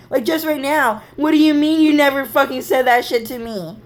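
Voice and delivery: high, loud indignant retort; retold angry accusation. Re-enacting heated quarrel with sister.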